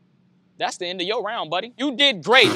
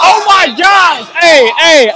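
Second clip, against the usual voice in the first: host stunned by the verse, loud amazed shout; loud, drawn-out amazed outburst; 3 semitones higher, 10 dB louder, slower than usual. Real, not acted. Yell it over a roaring crowd.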